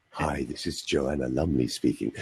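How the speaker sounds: low, soft, open voice; totally relaxed throat, voice dropped right down; quiet, deep relaxed impression; Joanna Lumley. Shown as a demonstration. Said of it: "Think of an open voice".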